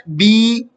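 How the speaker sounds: "بی" with a long, held "ee"; drawn-out vowel. Shown as a demonstration. Teacher demonstrating zer turning into ya, "بی" held long. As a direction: stretch the vowel of "بی" slowly. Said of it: "اس کو کھینچ کر پڑھتے ہیں".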